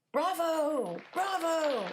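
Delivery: loud, high cheer from the crowd; cheering bravo. A narrator's reading. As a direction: shout it like a delighted audience. Audience cheering the dog.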